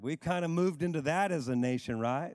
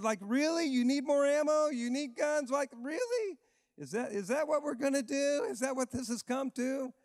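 incredulous disbelief; 8 semitones higher than usual. Acted. Mocking hoarders with disbelief.

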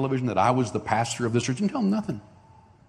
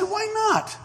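far higher, astonished question; genuinely baffled, pitched way up; baffled why not. Retold or imitated. Pastor baffled family refuses prayer.

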